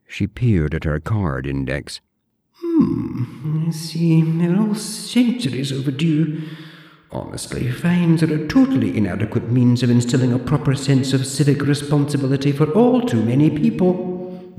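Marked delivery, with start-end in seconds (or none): 2.5-14.5 put-on high, prim old librarian voice; haughty zombie librarian, clipped and proper; librarian Deirdre. Deirdre lectures the borrower in a high prim voice. Deirdre reads her card index.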